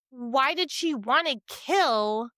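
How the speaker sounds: ordinary talk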